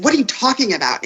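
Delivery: quick, high-pitched mock indignation; retold annoyance. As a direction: re-enact an irritated comeback. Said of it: "I'm mad because he didn't get home".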